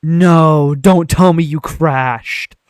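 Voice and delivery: loud, drawn-out disbelief; dismayed outburst. Real, not acted. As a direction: cry out slowly in dismay. Game crashes near the end.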